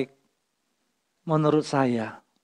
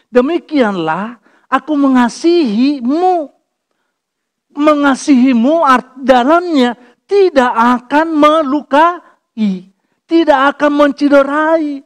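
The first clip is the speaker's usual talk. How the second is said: slow, high, loud preaching; preaching at full force; 8 semitones higher, 7 dB louder, slower than usual. Acted. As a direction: drive it home from the pulpit.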